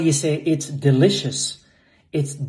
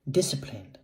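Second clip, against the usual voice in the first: ordinary talk, nothing marked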